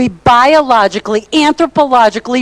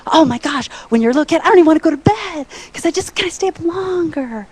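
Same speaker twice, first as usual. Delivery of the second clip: put-on higher, eager child's voice; lightly voiced excited child; excited little kid; 7 semitones higher, faster than usual. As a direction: gush like a kid who won't sleep.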